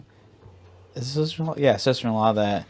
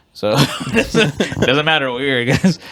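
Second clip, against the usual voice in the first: laughing through words; 5 semitones higher, 6 dB louder than usual. Real, not acted. Laughing while talking.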